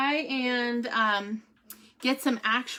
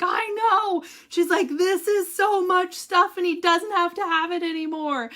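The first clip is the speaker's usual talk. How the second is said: higher, louder imitation of a gushing nurse; ecstatic nurse; 8 semitones higher, 6 dB louder than usual. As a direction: gush about the freed-up patient. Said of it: "she was, like, ecstatic".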